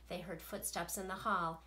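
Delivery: plain reading voice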